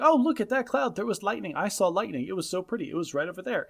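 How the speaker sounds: put-on high, dreamy child voice; father mimicking his daughter in a high voice. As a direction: gush like a distracted kid. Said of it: "she's like".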